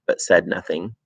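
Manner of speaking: usual speaking voice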